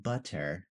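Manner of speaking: "butter" with a true T and final R; careful standard "butter". Shown as a demonstration. Teacher shows how it won't sound, enunciated "butter". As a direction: spell out the T in "butter", keep the R. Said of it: "it's not gonna sound like".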